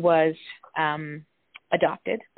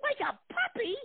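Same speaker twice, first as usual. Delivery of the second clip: much higher, squeaked incredulous voice; squeaky disbelief; slower than usual. Retold or imitated. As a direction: squeal it like a stunned cartoon. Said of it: "And I was like".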